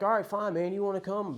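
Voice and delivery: loud, high, grudging invite; bad attitude. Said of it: "real bad attitude about it".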